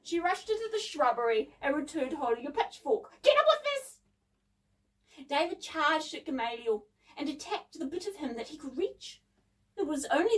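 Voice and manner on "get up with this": put-on very high, loud girl's shout; excited battle cry; urgent shout. A narrator's reading. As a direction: yell it out, voice way up. Girl urges brother into the fight.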